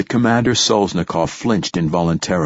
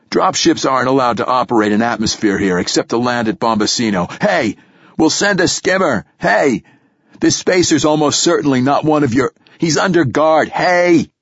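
building from firm to a loud high yell; shouting after them; 7 semitones higher, 3 dB louder than usual. A narrator's reading. Commander shouting at officers crossing the tarmac, building yell. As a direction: yell after them as they walk away, louder each time. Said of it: "shouted after them".

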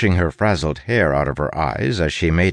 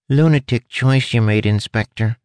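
pinched, higher-pitched rat voice; 5 semitones higher, 3 dB louder than usual. A narrator's reading. Caged rat chiding the inspector, pinched and higher. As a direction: squeeze the words out, pitched up. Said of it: "had a pinched quality to it".

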